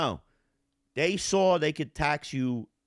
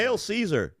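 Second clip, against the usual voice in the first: mock hail; 9 semitones higher, 4 dB louder than usual. Mock Roman salute.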